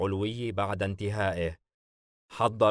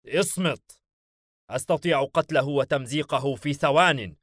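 higher, louder flare of temper; somewhat angry; 5 semitones higher, 5 dB louder than usual. A narrator's reading. Demon silencing a teasing comrade, somewhat angry. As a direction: cut him off with an irritated boast. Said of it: "بيلفيغور بشيء من الغضب".

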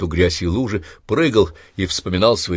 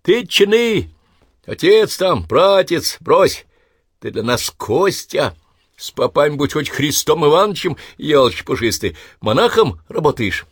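put-on gruff old neighbour; 8 semitones higher than usual. Skull teasing old neighbour loudly.

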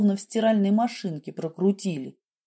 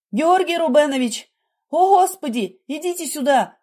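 high, loud panicked call; sudden alarmed cry; 7 semitones higher, 4 dB louder than usual. A narrator's reading. Nurse sees patient turning blue, crying out in alarm. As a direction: call out for help, well above usual pitch. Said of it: "вдруг закричала".

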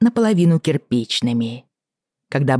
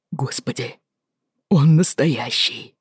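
awed whisper; slower than usual. Acted. Awed whisper at baby's kick.

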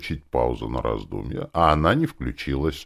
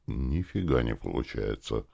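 ordinary delivery

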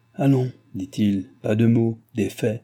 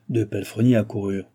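plain reading voice

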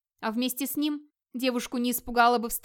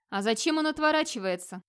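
ordinary delivery, nothing marked

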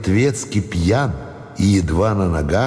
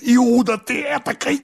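plain reading voice

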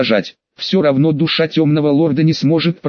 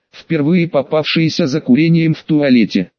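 usual speaking voice

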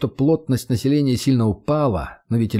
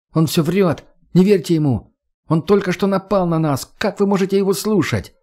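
rising, higher, desperate protest; emotional outburst; 8 semitones higher than usual. A narrator's reading. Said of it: "эмоциональную речь подростка". (подросток в отчаянии кричит, голос всё выше)

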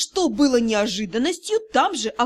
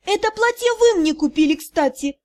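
high, louder indignant cry; indignant protest; 7 semitones higher, 3 dB louder than usual. Acted. Heroine protests to mother-in-law about dress, high. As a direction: protest loudly, pitched up.

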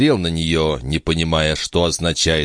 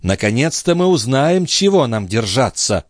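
high princess voice, settling at the end; eager exclamation; 6 semitones higher than usual. A narrator's reading. The princess expects answers from the cardinal, exclaiming. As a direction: exclaim with relief, starting high. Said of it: "воскликнула принцесса".

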